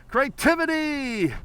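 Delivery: proud slow cheer; drawn-out exclamation. Real, not acted. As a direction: savour the word as a cheer. Host exclaims the word.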